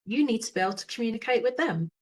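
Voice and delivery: normal talking voice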